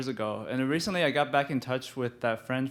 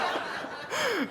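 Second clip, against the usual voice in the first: high laugh; 5 dB quieter than usual. Real, not acted. After retold yell.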